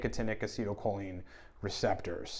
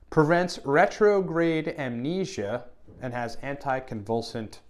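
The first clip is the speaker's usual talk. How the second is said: usual speaking voice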